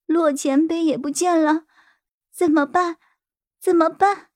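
high, helpless crying voice; high-pitched, anxious whimpering delivery; acted tearful panic. A narrator's reading. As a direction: plead on the edge of tears. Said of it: "冰雨晴突然急哭了".

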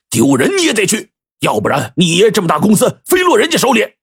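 high, heated fatherly order; angry insistence. Acted. 徐总拍桌子训儿子. (拍桌子般高声怒斥)

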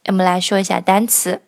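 normal talking voice

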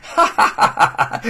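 high gleeful cackle; triumphant laugh. Acted. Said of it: "罗鹏兴奋地大叫起来". (兴奋的狂笑)

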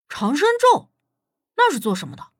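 high, puzzled echo of the unfamiliar term; curious question. Acted. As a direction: ask with keen curiosity, pitch jumping up at first. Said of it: "武迪好奇地问".

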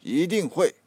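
Stalin insists, leaning hard on every word; emphatic. A narrator's reading. Stalin assuring Lenin, emphatic. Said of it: "斯大林强调说".

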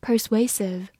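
usual speaking voice

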